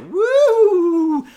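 long, high, loud "woo"; cartoon flying whoop. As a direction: whoop like a cartoon character sailing through the air. Mimics flying over the counter.

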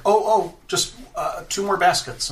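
a hint of a hurried scullery maid; flustered maid voice. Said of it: "she's like".

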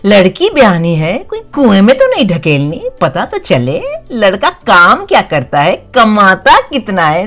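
lightly voiced fussy old aunt; quick, prying elderly aunt; Mausi voice. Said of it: "could you do the Sholay Mausi one for me".

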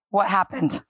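ordinary talk, nothing marked

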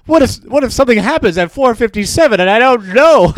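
mock-fret like a dad who might miss the news; a hammed-up dad fearing he missed something; panicky dad impression.

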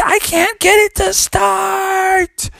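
very high, drawn-out yell; panicked dad. Acted. Dad can't start the car, panicked shout. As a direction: wail helplessly in acted panic.

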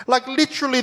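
normal talking voice

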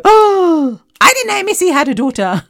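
high, loud mock-surprised gasp of a listener. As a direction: play the shocked fan who just found out. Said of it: "half of you go".

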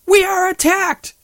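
hammed-up screaming alarm; mock scream. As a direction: shriek it like a panicked B-movie extra. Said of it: "one of the ugly girls screams".

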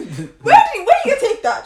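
where would you even take it, voice climbing; incredulous high outburst. Real, not acted. To friends about the Amazon van thief, high incredulous laughing cry. Mocking the robbery, loud and high.

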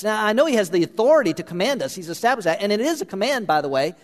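fervent preacher, high and pressing; preaching at full force. Acted. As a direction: drive the scripture home to them. Preaching scripture to congregation.

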